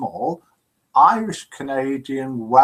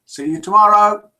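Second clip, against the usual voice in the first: cheery farewell; 6 semitones higher, 6 dB louder than usual. Retold or imitated. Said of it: "says Fee".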